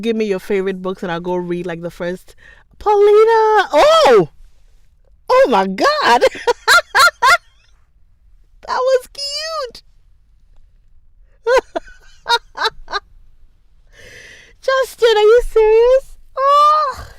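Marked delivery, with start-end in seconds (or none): 2.8-6.3 high, loud shout of joy; thrilled shout. Real, not acted. Greeting a new viewer, thrilled. 8.7-17.1 very high, loud squeals; giddy high shriek; squealing delight. Real, not acted. Reacting to viewer's compliment.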